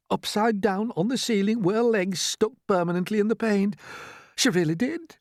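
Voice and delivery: high-pitched, distressed recollection; Miss Spider, heartbroken. A narrator's reading. Spider remembering feeding her stuck grandmother, heartbroken. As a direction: pour out the sad tale in a high voice.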